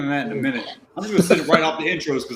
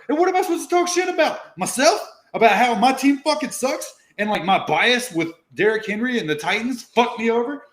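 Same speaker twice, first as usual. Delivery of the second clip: rising mock rant; 8 semitones higher than usual. Real, not acted. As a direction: wind yourself up into a frenzy. Mock rant about his own team.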